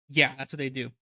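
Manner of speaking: usual speaking voice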